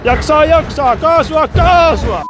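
loud high urging chant; cheering on. Real, not acted. Rider loudly urging the stalled coaster up the hill. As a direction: egg the ride on.

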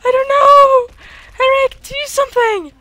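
high-pitched, helpless plea; panicked pleading. Real, not acted. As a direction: beg your partner to step in. Pleading with partner during boss fight.

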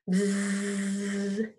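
buzzing like a bee; a long steady buzz. Shown as a demonstration. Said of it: "What sound does that animal make?".

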